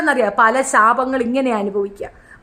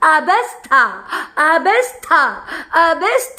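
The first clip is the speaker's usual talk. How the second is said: mocking repetition; 2 semitones higher, much slower than usual. Mocking the rival's word.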